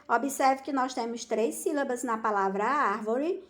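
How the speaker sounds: normal talking voice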